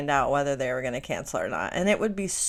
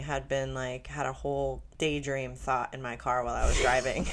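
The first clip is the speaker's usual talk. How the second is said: ordinary talk, nothing marked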